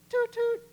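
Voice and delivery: loud, very high "toot toot"; playful train-whistle toot; high horn toot. Acted. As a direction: toot a little horn proudly. Tooting own horn.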